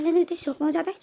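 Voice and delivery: ordinary delivery, nothing marked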